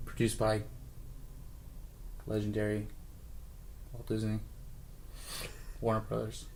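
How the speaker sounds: low, soft movie trailer voice; hushed dramatic trailer read; trailer narration. Acted.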